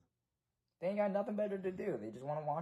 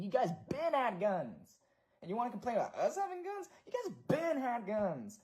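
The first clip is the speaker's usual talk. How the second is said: rising rant; 7 semitones higher than usual. Real, not acted. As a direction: climb into an outraged rant. Ranting at Proud Boys.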